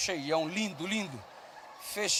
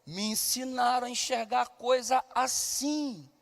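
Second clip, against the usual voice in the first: soft reflective refrain; 6 dB quieter than usual. Real, not acted. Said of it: "Porque faço e porque enxergo".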